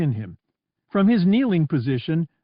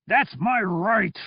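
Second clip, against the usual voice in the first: slow, higher, stubborn cry; defiant outburst; 7 semitones higher, slower than usual. Acted. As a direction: insist on it, slowly. Donos defends his wish to die.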